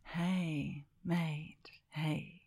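hushed, half-whispered Australian hello; soft Australian. Shown as a demonstration. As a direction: ease gently into a friendly Aussie greeting. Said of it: "I could go".

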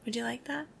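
normal talking voice

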